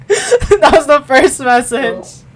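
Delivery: high, very loud, drawn-out cackling words; laughing disbelief. Real, not acted. Reader reacting to Soul's first text, laughing disbelief. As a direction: shout it while cracking up.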